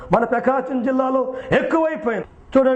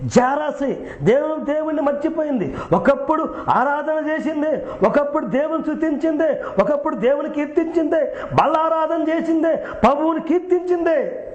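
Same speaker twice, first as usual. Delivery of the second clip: rising preaching cry; 6 semitones higher than usual. Acted. Preacher to the congregation, lamenting a woman who forgot God, rising preaching cry. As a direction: pile up each ఒకప్పుడు like a pulpit lament.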